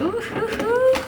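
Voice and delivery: rising delighted ooh sounds; excited hooting. Real, not acted. Excited about the sushi. (hululements excités)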